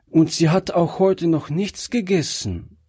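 put-on high-pitched little girl's voice; high child voice confiding in a grown-up; Gretchen. A narrator's reading. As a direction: add it plaintively as a child. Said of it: "sagte Gretchen zu dem alten Mann".

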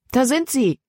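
high-pitched cry of discovery; excited exclamation. A narrator's reading. Libby spots the children climbing up the bank, excited exclamation. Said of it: "rief Libby aus".

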